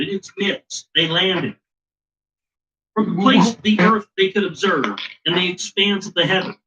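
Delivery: put-on measured, solemn reading of an old text; formal story reading. Host reading the Enki tablets to his co-host, solemn recital. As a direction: read it out like scripture.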